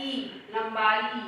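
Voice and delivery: slowly, leaning on the ई ending of लंबाई; slow teaching stress. Shown as a demonstration. Teacher showing pupils the ई ending, slow stress. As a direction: draw out ई, then लंबाई, slowly. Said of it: "अंत में क्या है?".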